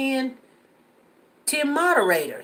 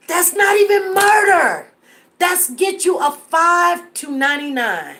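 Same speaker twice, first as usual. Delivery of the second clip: loud, higher outburst of disbelief; indignant shout at the weak charge; outraged; 5 semitones higher, 5 dB louder than usual. Real, not acted. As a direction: let the outrage burst out loud.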